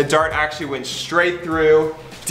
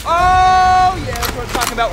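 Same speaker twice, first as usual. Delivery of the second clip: excited cheer; 12 semitones higher, 5 dB louder than usual. Real, not acted. Celebrating a hit.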